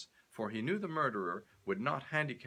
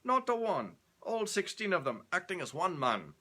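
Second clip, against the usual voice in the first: proud Scot; 9 semitones higher than usual. Acted. Stewart proudly to Leonardo, Scottish accent. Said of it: "he said proudly".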